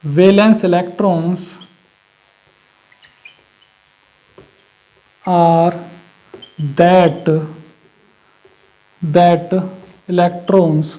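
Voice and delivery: words spaced out slowly while writing; slow dictation. Shown as a demonstration. Teacher dictating a definition to students, slow and spaced. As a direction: dictate each word slowly for notes. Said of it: "लिखवा देता हूँ".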